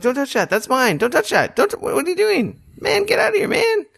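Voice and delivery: high falsetto mock scream; imitated frantic character screech, no real volume; Morty screaming. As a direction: squeal at them to back off in a cartoon voice. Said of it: "he starts screaming at you more".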